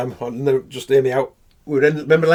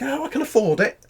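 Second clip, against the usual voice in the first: mocking her posh tone; 10 semitones higher than usual. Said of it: "She's like".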